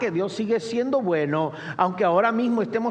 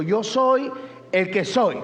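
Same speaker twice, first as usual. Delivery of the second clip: raised, high-pitched sermon delivery; full-force preaching; 5 semitones higher, 3 dB louder than usual. Real, not acted. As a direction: proclaim God's promises to the congregation. Preaches God's promises at full force. (predicación ferviente, con voz elevada)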